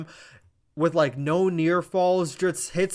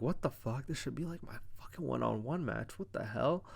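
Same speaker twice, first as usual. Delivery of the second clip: much softer, grumbling under the breath; muttered disbelief; 12 dB quieter than usual. Retold or imitated. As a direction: mutter it like you've been snubbed. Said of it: "Orton's kinda looking like".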